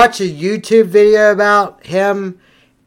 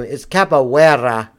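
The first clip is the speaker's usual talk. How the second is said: capoeira said slowly with a Brazilian accent; capoeira in a put-on Brazilian accent; 6 semitones lower than usual. Shown as a demonstration. Teasing friends, he says capoeira in a hammed-up Brazilian accent. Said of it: "but I'm going to".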